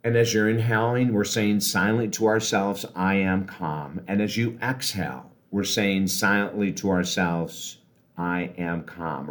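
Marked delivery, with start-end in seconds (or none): 2.9-4.0 slow, steady mantra; slow calm affirmation. Shown as a demonstration. Coach models silent words for inhaling, slow and calm. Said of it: "do it very slowly". 8.2-9.3 slow, softer, lower mantra; slow calm affirmation. Shown as a demonstration. Coach models silent words for exhaling, slow and calm. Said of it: "do it very slowly".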